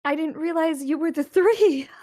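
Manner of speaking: confiding, a little embarrassed; eager wife. Acted.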